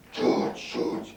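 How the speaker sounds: whispered, breathless plea from the wife; breathy wife whisper. Retold or imitated. Wife whispering his name at the zoo. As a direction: hush it like the wife does. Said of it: "She talks like that".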